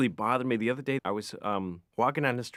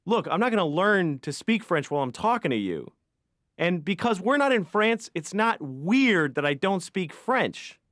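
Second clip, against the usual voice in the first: exasperated retort; 7 semitones higher, 5 dB louder than usual. Retold or imitated. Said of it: "And I was like".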